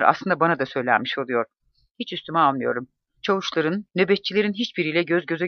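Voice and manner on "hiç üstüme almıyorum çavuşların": ordinary delivery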